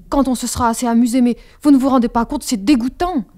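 put-on loud, fast indignant girl; indignant outburst. A narrator's reading. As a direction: blurt it out, trembling with indignation. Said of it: "L'indignation me faisait trembler". (indignée, forte et rapide)

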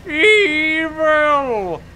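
high, loud hammed-up villain cry of evil; mock-sinister exclamation; dramatic evil. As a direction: boom the word Evil like a cartoon villain.

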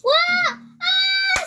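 sudden high, loud yell; startled scream. Real, not acted. Player caught out in game, startled scream. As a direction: shriek out in alarm.

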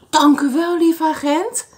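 high, loud happy thanks; storybook old lady thanking the policeman; grateful old lady. Acted. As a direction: call out thanks joyfully. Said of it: "riep de oude dame".

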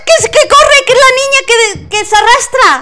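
shrieking high and loud in panic; alarm shout. Real, not acted. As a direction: scream it while running for your life. Fleeing the crawling girl ghost.

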